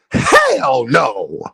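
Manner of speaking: deep, low mock "Hell no"; imitating Joseph. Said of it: "he like".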